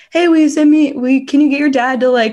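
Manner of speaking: pestering fan. Retold or imitated. Fans begging coach's daughter for a favor, mock pleading. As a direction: gush out the request like an eager fan.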